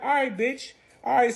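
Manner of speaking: high, challenging acceptance; high-voiced affronted reply; retold warning. Acted warning reply after quarrel.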